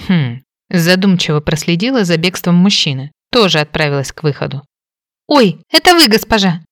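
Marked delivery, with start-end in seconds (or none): 5.3-6.6 frightened yelp, much higher and louder; startled cry. A narrator's reading. Maid Molly startled by her mistress, crying out in fright. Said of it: "испуганно вскрикнула Молли".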